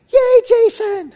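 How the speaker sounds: very high, slow cheer of his name; wife cheering. Retold or imitated. Wife cheering her husband from the sidelines, very high. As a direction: cheer him on in a very high squeal. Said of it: "you could hear my wife saying".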